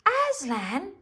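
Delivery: high, rising excited question; thrilled and curious; excited shiver. A narrator's reading. Lucy hearing of Aslan, excited question. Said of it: "cried Lucy with a shiver of excitement".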